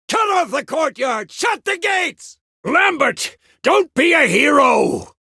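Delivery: urgent commands yelled over combat; battle shouting. Acted. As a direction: shout desperately to your comrades. Shouting orders during a battle.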